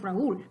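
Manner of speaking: RAÚL split as ra-ÚL; two-syllable Raúl. Shown as a demonstration. Teacher introducing the next example, two-syllable ra-ÚL. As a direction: stress a separate ú in Raúl. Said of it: "We know it's Raúl".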